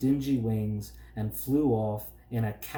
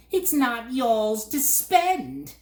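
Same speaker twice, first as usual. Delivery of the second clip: high, slow shrieking bird voice; 12 semitones higher, 6 dB louder, slower than usual. A narrator's reading. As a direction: screech it like a nasty bird. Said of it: "the bird shrieked again".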